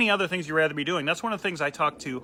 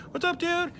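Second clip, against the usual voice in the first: high, bright-pitched fake cheer; chummy bartender greeting to a nameless regular; fake buddy greeting; 14 semitones higher than usual. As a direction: sell the chummy hello you don't mean. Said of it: "I was always like".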